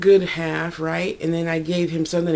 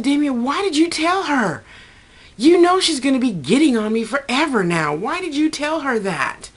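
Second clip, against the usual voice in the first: mock scolding; 9 semitones higher than usual. Retold scolding of Damien.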